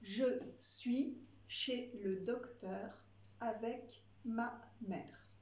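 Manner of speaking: each word stretched out slowly; slow dictating. Shown as a demonstration. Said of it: "C'est parti".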